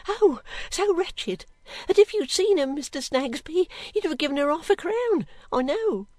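put-on high, wailing servant girl; distressed cry. A narrator's reading. Girl telling Mister Snagsby of the wretched woman, pitying and upset. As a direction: pour out pity in swooping, high plaintive phrases. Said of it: "cried the girl".

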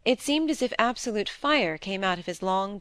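ordinary delivery

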